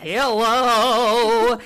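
hint of a Russian accent on a big hello; a loud mock-Russian greeting. Guest greets the hosts in a put-on Russian accent. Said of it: "it sounded a little Russian".

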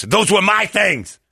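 high, drawn-out mock outrage; indignant outburst. Lady protests to the men about her things, indignant. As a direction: imitate an aggrieved accuser, slow and high. Accuser's outburst in retold quarrel.

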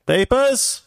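high-pitched mock German officer demanding papers; German accent. Host mocking vaccine passports, mock German accent. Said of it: "I can do that accent".

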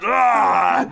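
sudden short rage yell; Hulk-out roar. Retold or imitated. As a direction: burst out with a roaring shout. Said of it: "just rip open his jacket like".

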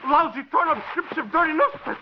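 enraged discovery. Acted. Gunman finds newspaper in the suitcase, furious Greek outburst. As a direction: rage at the worthless paper.

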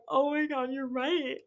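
half-hearted teary squeak; imitating herself bawling; mock crying. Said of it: "I'm sitting there like".

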